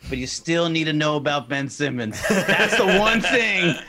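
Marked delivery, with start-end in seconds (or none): 2.3-3.8 laughing. Real, not acted. Punchline through laughter.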